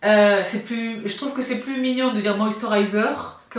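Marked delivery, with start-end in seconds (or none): 2.4-3.4 English word in a French accent; "moisturizer" pronounced the French way. Shown as a demonstration. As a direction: say moisturizer with cute French pronunciation. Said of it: "c'est mignon de parler avec l'accent français".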